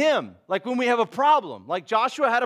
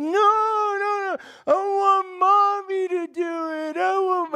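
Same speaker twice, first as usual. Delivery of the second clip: exaggerated kid whine; 10 semitones higher, slower than usual. Boy begging for mommy to pull his tooth, high wailing plea. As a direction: wail for mommy like a frightened little boy. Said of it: "he's really, like, exaggerated".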